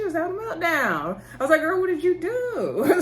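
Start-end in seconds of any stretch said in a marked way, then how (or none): 1.6-3.0 high, slow, drawn-out disbelief; incredulous sass. Pretend. Vlogger asks the stressed mom on screen, high and slow. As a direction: stretch it out like you can't believe her. Reacting to meltdown on the show.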